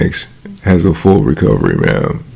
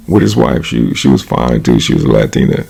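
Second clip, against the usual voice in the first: ordinary talk, nothing marked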